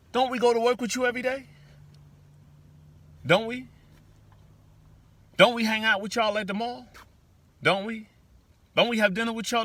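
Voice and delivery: high, pleading questions; mock pleading voice. Voicing brown people to others.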